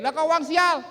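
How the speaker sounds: high, loud acted protest; acted incredulous wife; wife's indignant retort. Wife challenges husband's excuse.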